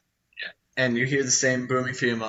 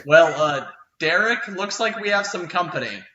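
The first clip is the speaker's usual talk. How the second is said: calling out; 5 semitones higher, 4 dB louder than usual. Acted. Orc calls back about intruder.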